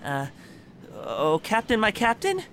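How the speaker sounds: high, hesitant and sheepish, rising loud on "my captain". Acted. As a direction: nervously address the captain. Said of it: "sheepishly walks over".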